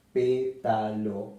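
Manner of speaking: pétalo slowly, PÉ stressed; drawn-out pétalo with PÉ leaned on. Shown as a demonstration. Teacher slowing pétalo to show its stress. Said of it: "se acentúa en la antepenúltima".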